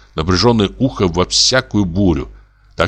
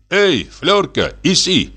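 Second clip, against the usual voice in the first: calling out; 9 semitones higher, slower than usual. A narrator's reading. Hunter calls his dog from under the bench, calling out. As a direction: call the dog with lifted pitch. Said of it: "крикнул он собаке".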